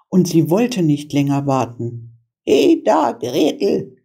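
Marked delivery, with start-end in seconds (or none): 2.4-4.0 put-on high witch hail to the girl; impatient old witch, pitched up; witch calling out. A narrator's reading. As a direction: call sharply as an impatient witch. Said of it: "rief sie dem Mädchen zu".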